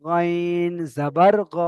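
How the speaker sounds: গাইন জাবার গ, very slow, গ kept short; slow short-vowel reading. Shown as a demonstration. Teacher to students, slow recitation. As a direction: spell slowly, don't stretch গ. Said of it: "এটা হারাকাতের পড়া".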